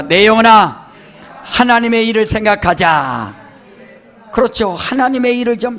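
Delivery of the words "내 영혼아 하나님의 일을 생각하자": loud, rousing declaration; call to repeat. Shown as a demonstration. Pastor leads the congregation in a loud, rousing recitation. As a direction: proclaim it for the congregation to echo. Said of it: "따라합시다".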